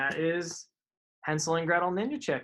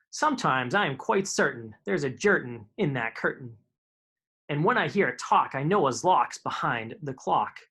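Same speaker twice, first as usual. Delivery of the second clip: playful, bouncy rhyming reading; Dr Seuss read-aloud. A narrator's reading. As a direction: read silly rhymes to kids.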